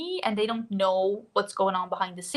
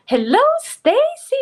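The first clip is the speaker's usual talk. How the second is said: high, loud, drawn-out hello; excited greeting; 10 dB louder than usual. Real, not acted. Streamer spotting a friend in the chat, excited greeting. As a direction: call it out high and slow, delighted. Calls out greeting to arriving viewer.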